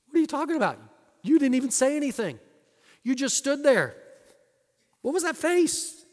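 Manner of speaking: high, loud disbelief; can't believe what he's hearing; incredulous. Retold or imitated. Incredulous reply to friend.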